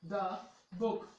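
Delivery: "द" short before "book"; "द book" with short article. Shown as a demonstration. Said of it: "और हम देख सकते हैं". ("द book" में छोटा "द")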